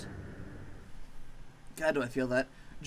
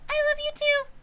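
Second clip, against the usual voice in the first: much higher, louder squeaky threat; squeaky tiny maggot; 4 dB louder than usual. Acted. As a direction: squeak a tough-guy warning.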